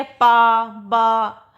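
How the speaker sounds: पा, बा each said slowly with a long open aa; clear aa sound. Shown as a demonstration. Teacher sounding पा and बा for students, stretching the aa. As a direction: draw out the aa in पा and बा for the class. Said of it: "आ का sound आ रहा है".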